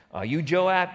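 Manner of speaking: woman demanding to know; urgent call. Retold or imitated. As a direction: call it out to make sure it's him. Woman's urgent question retold.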